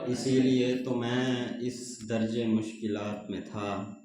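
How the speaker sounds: measured ghazal recitation, building in force on repeated lines; ग़ज़ल पढ़ते हुए. Acted. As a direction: declaim each line to the crowd, lingering on the refrain. (मुशायरे का शायराना अंदाज़)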